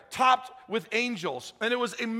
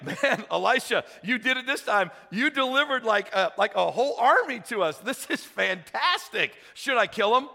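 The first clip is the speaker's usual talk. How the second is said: excited king; 3 semitones higher, 4 dB louder than usual. Retold or imitated. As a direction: gush like you've won big. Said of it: "the king of Israel is like".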